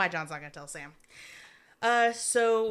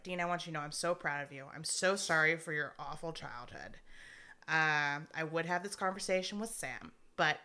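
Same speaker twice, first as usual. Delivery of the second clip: soft farewell; 3 semitones lower, 6 dB quieter than usual. Retold or imitated. Dying father's farewell to son.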